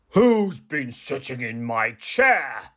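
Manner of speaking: slow, gruff Father Bear; gruff, drawn-out demand; great gruff growl. Acted. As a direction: growl it slowly like a big bear. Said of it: "he growled in his great gruff voice".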